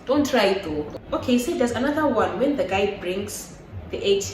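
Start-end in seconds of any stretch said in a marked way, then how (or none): 0.0-0.8 mock pushy boyfriend. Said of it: "imitating the pushy boyfriend".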